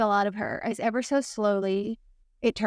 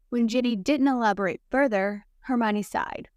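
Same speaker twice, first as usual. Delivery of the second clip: ordinary delivery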